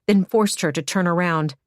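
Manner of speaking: ordinary delivery, nothing marked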